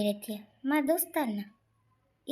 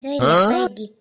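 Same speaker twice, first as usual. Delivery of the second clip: loud gloat; 2 semitones lower, 9 dB louder than usual. Acted. Husband gloats as wife leaves.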